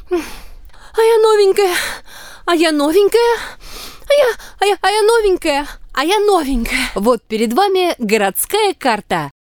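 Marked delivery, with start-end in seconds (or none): 0.1-6.9 put-on high, singsong little girl. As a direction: chant it like a proud little girl. Girl repeating she's new. (голос маленькой девочки)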